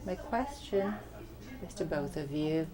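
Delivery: ordinary talk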